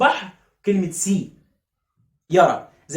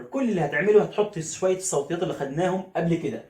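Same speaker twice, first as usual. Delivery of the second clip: ordinary talk, nothing marked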